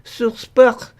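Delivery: put-on European French reading voice; theatrical European French; European French accent. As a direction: play an effusive Frenchman. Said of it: "That's my French from".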